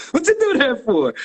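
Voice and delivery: very high-pitched mock complaint; indignant protest. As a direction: act out yelping at an uncalled-for smack. Repeats his protest after smack.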